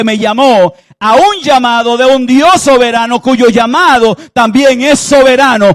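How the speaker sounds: loud, high, impassioned confession; Jonah's confession. Acted. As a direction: throw yourself on the sailors' mercy. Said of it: "Jonás termina diciéndole a los del barco".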